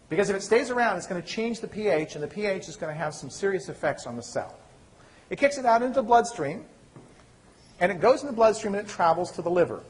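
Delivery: ordinary delivery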